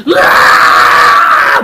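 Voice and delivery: loud breathy imitated crying wail; imitated child wail. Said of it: "그 아이처럼". (아이 울음소리 흉내)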